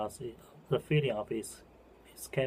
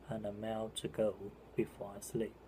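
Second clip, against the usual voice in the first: softer closing refrain; 7 dB quieter than usual. Real, not acted. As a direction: let the last line of the poem settle quietly. Closing repeat of poem's refrain.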